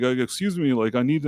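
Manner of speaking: usual speaking voice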